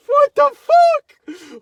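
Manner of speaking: slow, drawn-out, very loud and high "What the fuck?"; loud disbelieving yell. Retold or imitated. The wrong version of his reaction, a huge slow yell. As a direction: bellow "What the fuck?" at the top of your voice. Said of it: "it wasn't like that".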